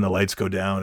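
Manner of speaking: ordinary talk, nothing marked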